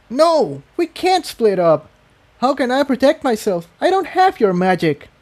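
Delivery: put-on high, panicked young mare pleading; aghast. A narrator's reading. Tiptoe begging the unicorns not to split up, frightened.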